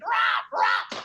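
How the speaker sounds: loud squawking parrot cry. Retold or imitated. Comic imitating the bird squawking.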